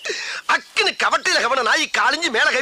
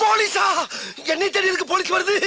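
shocked; 8 semitones higher than usual. Acted. Man shocked that police came looking for him, panicked. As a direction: gasp out the question in panic.

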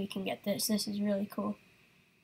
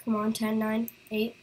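ordinary talk, nothing marked

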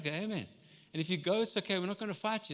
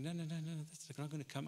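inner refusal; 9 dB quieter than usual. Retold or imitated. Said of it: "And I think".

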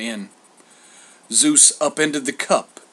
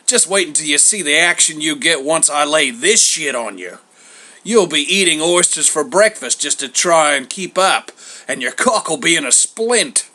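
loud, excited huckster pitch; hyped-up, raised-voice sales patter; hard-sell Zeus; 5 semitones higher, 9 dB louder than usual. Acted. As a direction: sell it big, like a brash promoter. Zeus hard-sells the job.

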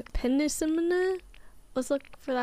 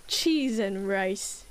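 drawn-out minced oath; 4 semitones lower, much slower than usual. Real, not acted. Minced oath for Jesus Christ.

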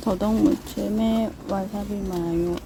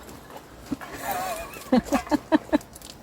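breathy laugh; 5 semitones higher than usual. Real, not acted. Laughing at memory.

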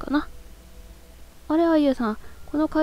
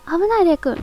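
alarmed warning; 5 semitones higher, 6 dB louder than usual. Acted. Warning cry before explosion.

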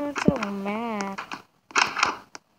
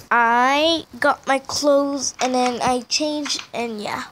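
normal talking voice

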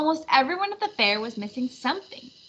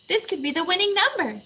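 put-on higher, eager little girl's voice; thrilled at her find; bright excited child; excitedly; 8 semitones higher than usual. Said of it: "she said excitedly".

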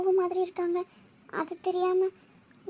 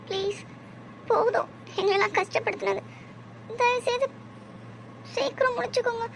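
crying, voice rising high as she begs; sobbing plea, pitched up; tearful plea; 4 semitones higher than usual. Acted. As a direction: beg him through tears. Pleading over an unconscious friend.

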